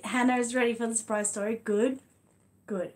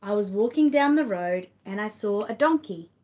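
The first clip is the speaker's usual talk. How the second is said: big, louder read-aloud voice, stretched slowly; reading to children; 2 semitones higher, 6 dB louder, slower than usual. Acted. Reading The Wonky Donkey to her son and viewers, lively read-aloud. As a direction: tell it like a lively picture book for little ones. Reads picture book aloud.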